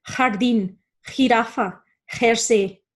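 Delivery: jardín, jirafa, jersey starting with a throaty H; Spanish J in jardín, jirafa, jersey; guttural J. Shown as a demonstration. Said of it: "we've got that guttural H".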